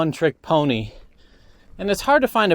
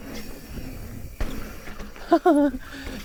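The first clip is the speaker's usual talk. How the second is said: breathy laugh; 9 semitones higher, 6 dB quieter than usual. Real, not acted. Laugh after halftime joke.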